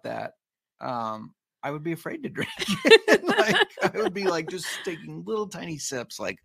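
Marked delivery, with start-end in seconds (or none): 1.6-6.2 laughing. Real, not acted. Laughing at pricey bottle.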